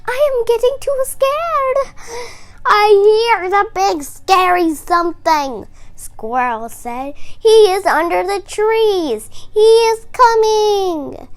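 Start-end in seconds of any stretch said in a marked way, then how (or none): 0.1-5.7 very high, loud frightened voice; scared animal voice. Acted. Scared raccoon and squirrel. 7.2-11.3 high, loud fearful warning; scared squirrel. Acted. Squirrel hears the monster.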